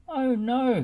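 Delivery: high, loud alarmed gasp. A narrator's reading. Bob gasping on seeing the hedgehogs, high and loud. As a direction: gasp as you spot danger. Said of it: "he gasped".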